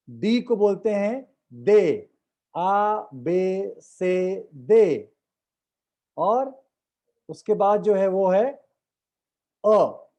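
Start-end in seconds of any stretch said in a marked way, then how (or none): 2.6-5.1 आ, बे, से, दे drawn out, slowly. Shown as a demonstration. Teacher slowly modelling French letters आ to दे. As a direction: stretch each letter slowly. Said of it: "बड़े धीमे से बोलता हूं".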